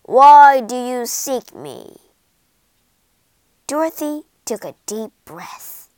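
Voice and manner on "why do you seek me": very loud, imposing giant's question; booming. A narrator's reading. Giant head questioning Dorothy, booming. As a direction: thunder the question out. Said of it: "boomed a giant head".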